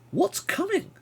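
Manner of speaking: very high-pitched asking; anxious question. Acted. Player asks a strange companion what is coming, high-pitched. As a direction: jump right up high on the question.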